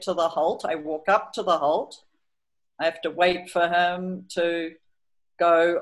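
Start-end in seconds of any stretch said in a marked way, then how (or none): none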